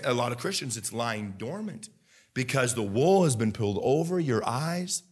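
usual speaking voice

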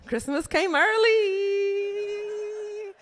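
high, long drawn-out squeal; mock-tearful delight. Comic reacts to boyfriend's remark, high drawn-out delight. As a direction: stretch it out high and overjoyed.

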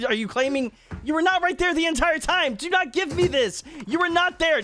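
high-pitched, indignant disbelief; indignant protest. Real, not acted. Disputing another player's denial.

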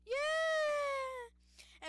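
very high, loud triumphant yell; victory cheer. Real, not acted. Player cheering after winning the race.

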